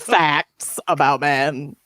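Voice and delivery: slow, drawn-out mocking quote; stretched-out sceptical quotation; air quotes. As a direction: say it slowly in audible air quotes. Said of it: "air quotes".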